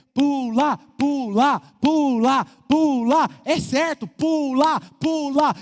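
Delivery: loud, rising chant of pula, pula; crowd yelling jump. Retold or imitated. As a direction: egg him on like a jeering crowd below. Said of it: "tá lá embaixo dizendo". (multidão gritando pula, pula, bem alto e insistente)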